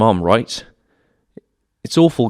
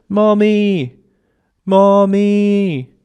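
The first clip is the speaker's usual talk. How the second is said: high, loud wail of Mommy; calling out; 10 semitones higher, 4 dB louder, much slower than usual. Retold or imitated. Child calls for mom from the window, high loud cry. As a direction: call far out into the night, much higher. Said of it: "put your head through an open window and shout".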